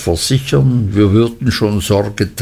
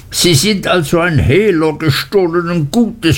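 screamed accusation; 8 semitones higher than usual. A narrator's reading. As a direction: shriek the charge at him. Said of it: "schrie Uriah".

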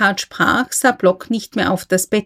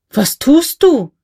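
raised, higher, slower outcry at Block; sharp outcry; 6 semitones higher, 4 dB louder, slower than usual. A narrator's reading. K. snaps at Block kneeling before the lawyer, raised outcry. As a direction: call out sharply in alarm. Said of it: "rief er".

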